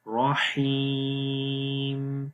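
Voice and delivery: very slow, stretch the ee in رحیم; long yaa. Shown as a demonstration. Teacher showing the long ee. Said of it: "یہاں پر بھی لمبا کریں گے".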